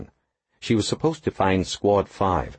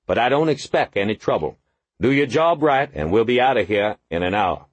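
gruff lieutenant; 7 semitones higher than usual. Acted. Gruff squad lieutenant briefing.

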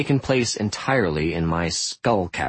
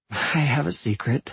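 groggy voice; 10 semitones higher than usual. Acted. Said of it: "in the groggy voice of someone whose eyes are closed".

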